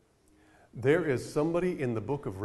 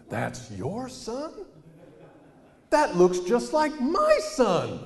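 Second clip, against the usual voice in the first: put-on much higher, slow, then louder old-lady voice; hammed-up astonished old lady; amazed older lady; 10 semitones higher, 5 dB louder, much slower than usual. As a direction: gasp it out like a stunned elderly woman. Older ladies voiced in story.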